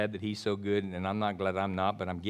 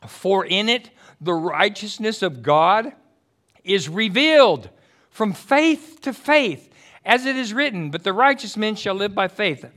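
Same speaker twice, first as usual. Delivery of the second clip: scripture reading; 9 semitones higher, 8 dB louder than usual. Acted.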